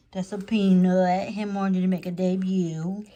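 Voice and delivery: slow doting pet talk. Real, not acted. Cooing at the pet.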